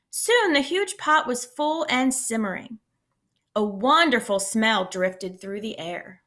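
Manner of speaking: lively read-aloud storytelling voice; expressive picture-book reading; story narration. A narrator's reading. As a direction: read it to a class of kids.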